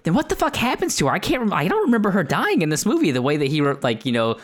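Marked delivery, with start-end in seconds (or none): 0.0-3.1 mock baffled outburst. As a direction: blurt it out like you can't believe the movie did that. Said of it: "I'm like".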